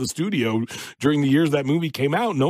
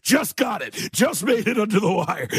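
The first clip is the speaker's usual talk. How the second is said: gleeful mock shout; 7 semitones higher than usual. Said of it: "was like".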